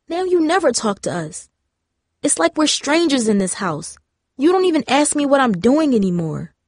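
frustrated. A narrator's reading. Darcy confronting her parents about drifting apart, frustrated. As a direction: pour out a pent-up complaint. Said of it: "frustrated at her own words".

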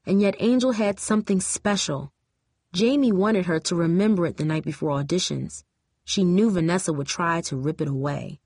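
ordinary delivery, nothing marked

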